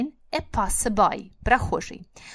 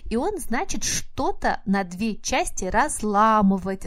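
normal talking voice

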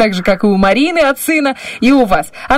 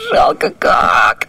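mock sad; much slower than usual. Host laughs at missed word.

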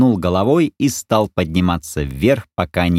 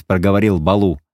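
ordinary delivery

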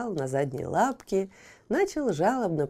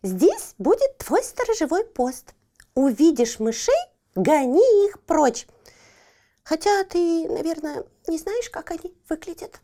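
bossy cat; 7 semitones higher, 4 dB louder than usual. Acted. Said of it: "задумалась Барбариска".

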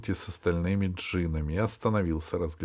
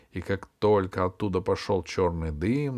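ordinary delivery

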